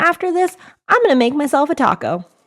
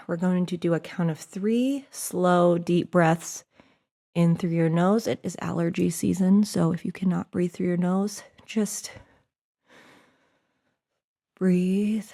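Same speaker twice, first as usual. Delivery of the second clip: guided breathing; 7 semitones lower, 8 dB quieter than usual. Acted. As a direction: guide them gently into the breath. Leads a breathing exercise.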